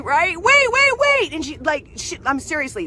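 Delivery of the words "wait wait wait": much higher, loud yell of "Wait!"; screamed wait. Retold or imitated. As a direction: scream it to stop the closing door. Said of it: "she screams at the top of her lungs".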